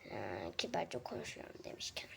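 soft whisper to keep quiet. Real, not acted. Boy whispering to viewers while mom sleeps, whisper. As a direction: whisper so mom stays asleep. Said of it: "Sessiz olmalıyım".

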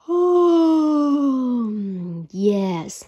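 loud, drawn-out bear voice; sleepy bear. Acted. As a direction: boom it out like a big yawning bear. Bear yawns and answers.

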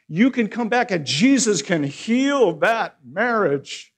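loud, high-pitched sermon delivery; impassioned preaching. Real, not acted. Pastor urging the congregation that broken marriages can heal, impassioned preaching. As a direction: preach it with force, driving each point home.

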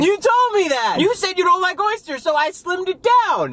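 high-pitched indignant whine; whiny protest. Real, not acted. Friend complains about oyster order.

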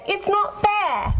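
put-on slow, high, whiny British child protesting; British accent, whiny. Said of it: "that was an awesome British accent".

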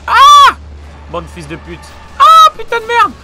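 very high, very loud scream and swear; real pained yell; furious scream. Real, not acted. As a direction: rage at the hit. Hit by enemy.

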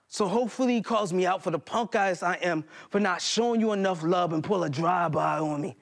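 ordinary delivery

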